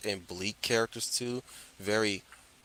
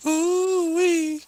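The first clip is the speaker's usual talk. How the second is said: excited whoop; 7 dB louder than usual. Real, not acted. Reacting to the opening song.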